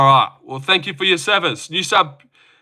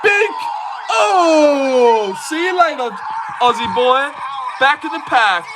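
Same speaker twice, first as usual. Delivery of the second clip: gloating cheer; 12 semitones higher, slower than usual. Real, not acted. Cheering his correct KO prediction.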